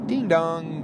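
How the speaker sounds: sing-song high ding dong; doorbell imitation. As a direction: chime it like a doorbell.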